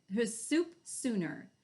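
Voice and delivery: usual speaking voice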